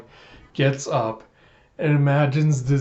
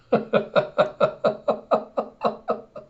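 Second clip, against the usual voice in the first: breathy high laughter; 8 semitones higher than usual. Real, not acted. Laughs at corgi image.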